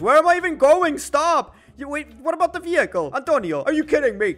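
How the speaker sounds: panicked outburst. Real, not acted. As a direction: yell at the screen in disbelief. Panicking at the game.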